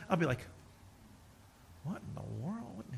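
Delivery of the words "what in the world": puzzled face aside. Retold or imitated. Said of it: "My wife corrects me all the time".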